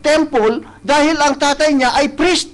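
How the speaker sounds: impassioned pulpit delivery; preaching. Acted. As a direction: preach it out to the congregation. Preaching continues at full force.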